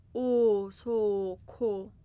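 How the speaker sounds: او سو کو stretched out slowly and loud; slow recital. Shown as a demonstration. Teacher recaps the three words loudly for children. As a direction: sound out او سو کو syllable by syllable. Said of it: "او میاد زیرش".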